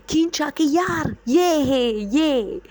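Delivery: high and loud, pointing it out; urgent shout. Retold or imitated. Sister shouting to calm the screaming child, urgent. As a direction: yell it's just this, the necklace. Sister yanks the necklace, shows cause.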